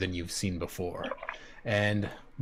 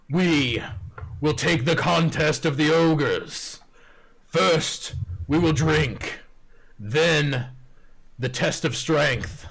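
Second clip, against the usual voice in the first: loud, higher proclaiming ogre voice; ogre champion; 6 semitones higher, 3 dB louder than usual. Acted. Ogre proclaims the traditional contest rules, loud and proclaiming. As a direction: announce each step like a proud ogre.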